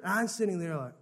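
normal talking voice

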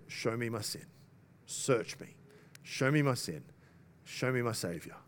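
low, soft plea with pauses; quiet prayer. Acted. Acted prayer over the open Bible.

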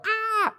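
high, loud squawk like a dove; mock dove cry. Imitated dove landing.

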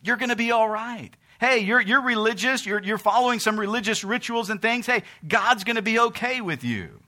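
loud, high preaching cry; full-force preaching. Acted. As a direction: preach it out at the top of the voice.

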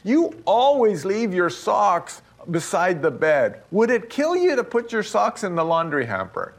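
a hint of a nagging wife; wife's complaint. Husband imitates his frustrated wife, mildly mock scolding. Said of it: "she goes".

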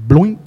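a loud, rising bloink; loud splash noise. Shown as a demonstration. Preacher to congregation, loudly imitating the splash. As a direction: mimic the plop of an axe sinking. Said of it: "that's the noise something makes".

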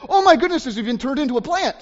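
high, loud mock astonishment; mock shocked gasp. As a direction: exclaim it in hammed-up disbelief. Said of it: "Nobody gasps and said".

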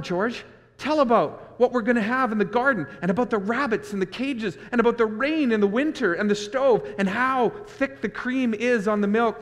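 high, loud, tumbling eager pleading; excited Lenny. Acted. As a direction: rush in, begging for the dream. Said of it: "Lenny breaks in".

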